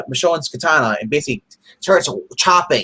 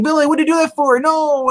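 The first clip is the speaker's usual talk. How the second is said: mock alarmed yell; 3 dB louder than usual. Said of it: "And they're like".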